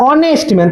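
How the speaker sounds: "অনেস্ট man" opening on the vowel O; silent H. Shown as a demonstration. Teacher showing what is really said, "অনেস্ট man" with silent H. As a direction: say "অনেস্ট man" dropping the H completely. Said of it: "কি বলছি?".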